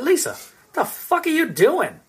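high, slow, baffled question; stunned high-pitched what are you doing; incredulous. Retold or imitated. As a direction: ask her in total disbelief. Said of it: "And I said".

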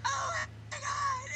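high wailing mock shout; mock yell. Blonde woman screaming at the corpse in her kitchen, mock shriek. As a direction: shriek it like a panicked horror heroine. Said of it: "she's just yelling".

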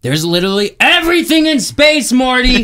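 high, loud, exasperated mad-scientist yell; Rick impression. Telling Morty off about space ghosts, shouting high. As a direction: bellow it like a fed-up cartoon genius.

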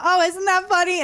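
high-pitched mock amusement; imitating amused parents. Parents amused by their false accusation, imitated high-pitched amusement. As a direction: mimic parents tickled by their own mistake. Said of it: "They thought it was so funny".

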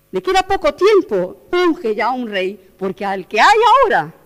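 loud, urgent proclamation; the preacher voices God's urgent command, loud; forceful preaching. Real, not acted. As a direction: thunder out the command.

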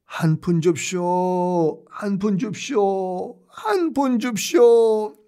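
high, slow whining beggar voice; beggar's plea. Retold or imitated. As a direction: drag out each plea like a street beggar. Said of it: "거지 행세를 하고 지나가요".

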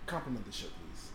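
low, hushed aside; imitated mom. Mom fishing for praise of her shirt, hushed imitation. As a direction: drop the voice into a quiet prompt. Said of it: "my mama legit would be like".

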